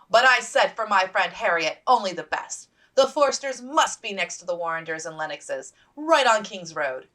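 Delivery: chattering officer's wife; hearty matron. Acted. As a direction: gush over your friend grandly. Mrs Warrender voiced.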